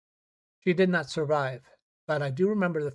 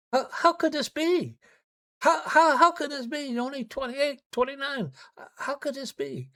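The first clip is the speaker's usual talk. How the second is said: high, pleading voice of a shocked relative; voicing a stunned, grieving family member; anguished family; 10 semitones higher than usual. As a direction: cry out in disbelief for the grieving family.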